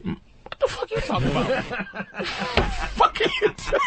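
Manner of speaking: squealing high through laughter; laughing disbelief. Real, not acted. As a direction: laugh it out, can't believe it. Incredulous laughing reaction.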